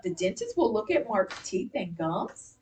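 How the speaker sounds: slow reading with the voice rising on "gums"; question intonation. Shown as a demonstration. Teacher echoes students' reading of question mark, rising tune. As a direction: turn it into a question, lift "gums" at the end. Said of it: "Your voice went up".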